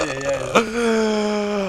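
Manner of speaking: drawn-out groan. Real, not acted. Disappointment at failed kill.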